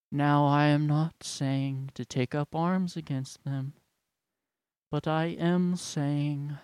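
slow, ponderous pulpit parody; mock church leader addressing the congregation; droning church elder. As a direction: drone solemnly with long pauses between phrases. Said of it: "they're all talking like this".

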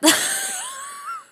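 shrieking laugh, very high. Real, not acted. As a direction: burst into a squealing laugh. Laughter at story end.

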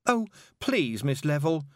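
high pleading whine; mild wail. A narrator's reading. Pupil begging her teacher, high wail. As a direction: plead high, half wailing. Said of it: "Tiffany wailed".

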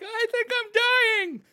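high-pitched panicked wail; acted-out hysterical panic; freaking out. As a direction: cry it out in hammed-up terror. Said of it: "so I'm freaking out".

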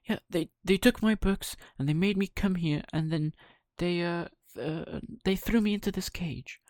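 high, stumbling, breathy puppet voice; nervous lying. Acted. Pinocchio stumbling through his lie, high and breathy. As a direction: fumble for excuses.

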